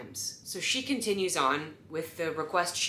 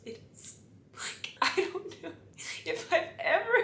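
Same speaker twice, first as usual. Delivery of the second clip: laughing; 13 semitones higher, 3 dB quieter than usual. Real, not acted. Laughing at the smell.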